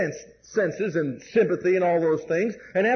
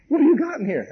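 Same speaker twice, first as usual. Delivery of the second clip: higher, puzzled coworker; surprised question; 6 semitones higher than usual. Retold or imitated. Coworker Mike lifting the heavy bucket, surprised. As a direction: sound amazed at the weight.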